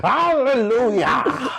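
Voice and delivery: high, drawn-out revival shout; preacher. Acted. Player answering the host's sermon riff, as a preacher. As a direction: cry it out like a stirred congregation. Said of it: "in a Presbyterian preacher's kind of voice".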